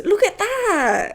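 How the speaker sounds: high, slow, stretched-out awe; excited squeal. Real, not acted. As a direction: gush over the gift. Excited over gifts.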